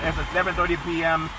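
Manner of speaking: ordinary talk, nothing marked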